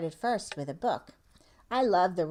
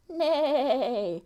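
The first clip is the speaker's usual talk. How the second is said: giggly horse whinny; playful whinny for the kids; laughing neigh; 5 semitones higher than usual. Pretend.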